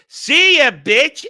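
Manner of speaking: high, loud parody of a movie line; mock sneering send-off. Quoting her Superman line as a high, loud mock jab. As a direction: spit out the quip, hammed up. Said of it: "a minute and a half of".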